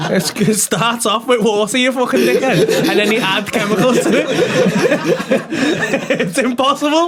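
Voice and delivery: can barely get the words out for laughing. Real, not acted. Laughing at the story.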